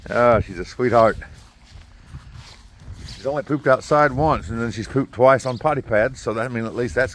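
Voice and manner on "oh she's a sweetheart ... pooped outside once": ordinary delivery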